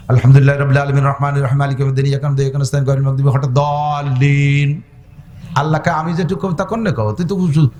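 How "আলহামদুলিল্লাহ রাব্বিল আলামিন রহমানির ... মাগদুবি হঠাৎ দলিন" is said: whole Fatiha raced through in one breath, fast and chanted; rushed recitation. Shown as a demonstration. Preacher showing the wrong way, reciting fast in one breath. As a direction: rattle it off without stopping to breathe. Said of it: "আর আপনি এক নিঃশ্বাসে মারিয়ে দিয়েছেন".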